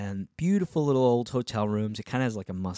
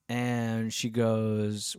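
normal talking voice

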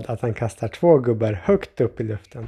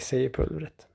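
plain reading voice

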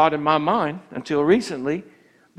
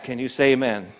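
normal talking voice

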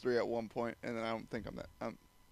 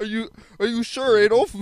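Shaggy voice; 8 semitones higher, 9 dB louder than usual. Retold or imitated. Continues Shaggy bit.

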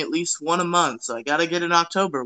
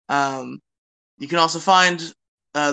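usual speaking voice

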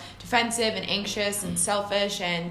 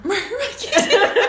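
laughing; 13 semitones higher, 7 dB louder than usual. Real, not acted. Cracking up over a slip.

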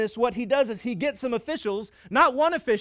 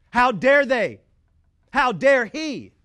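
mock indignant; 4 semitones higher, 7 dB louder, slower than usual. Voicing an offended believer.

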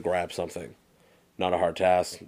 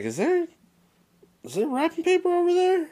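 much higher, coy, playful dad voice; sly dad. Retold or imitated. Dad hints at the hidden present, much higher and coy. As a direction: feign innocence about the hidden gift. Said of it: "He's like".

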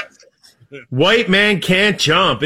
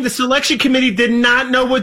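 usual speaking voice

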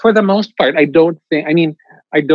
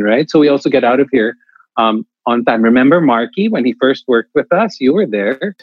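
usual speaking voice

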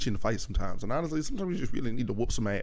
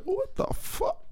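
high-pitched mock disbelief. Retelling his reaction to a game screen, squeaky disbelief. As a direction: squeal it like you can't believe it. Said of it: "I'm just like".

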